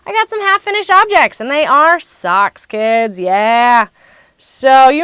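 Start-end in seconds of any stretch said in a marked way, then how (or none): 0.1-1.4 higher, louder, hammed-up sock fanatic; mock-dramatic fanatic reveal; crazy sock fanatic. Shown as a demonstration. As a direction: announce it like a proud fanatic. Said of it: "As your crazy, obsessed, sock fanatic woman".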